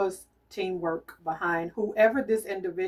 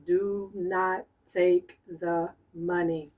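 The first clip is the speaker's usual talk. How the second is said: very slow, every word weighted; slow emphatic; much slower than usual. Real, not acted. Psychic giving the spirit's last warning, slow and weighted. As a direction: spell it out word by word as a final warning.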